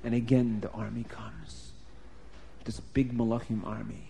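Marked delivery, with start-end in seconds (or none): none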